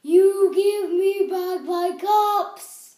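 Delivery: loud, slow, singsong scold; angry demand. Acted. Peddler demands his caps from the monkeys, loud singsong scolding. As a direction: drag out each word like a cross singsong.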